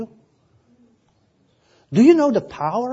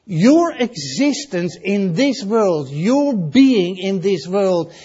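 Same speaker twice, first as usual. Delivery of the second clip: slow, weighty stress on "Your existence" and "your being"; emphasized; slower than usual. Shown as a demonstration. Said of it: "I want to emphasize this".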